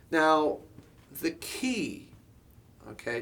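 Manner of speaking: ordinary delivery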